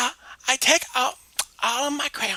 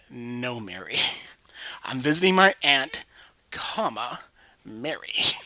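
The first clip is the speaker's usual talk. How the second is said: Jimmy voice; 10 semitones lower, slower than usual. Acted. Jimmy voice, low, explaining.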